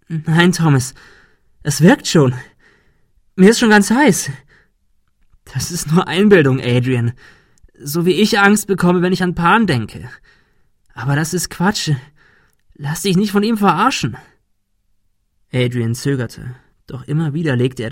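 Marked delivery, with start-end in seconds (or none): 0.1-4.3 high, loud, climbing protest; panicking. A narrator's reading. Adrian telling Thomas the poison is working, panicked. 5.5-14.2 high, insistent reassurance; urgent persuasion. A narrator's reading. Thomas urging Adrian not to be fooled, insistent. Talking down an armed friend.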